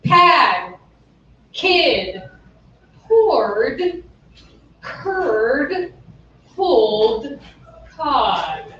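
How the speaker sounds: each word alone with long pauses; slow it right down, word by word. Shown as a demonstration. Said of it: "slowed down, everybody".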